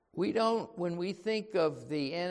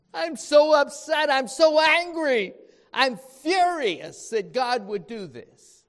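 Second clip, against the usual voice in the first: loud, high, exasperated outburst; furious Jonah; 9 semitones higher, 12 dB louder than usual. Acted. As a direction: rant at God like an offended prophet. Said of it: "It caused Jonah to be very angry".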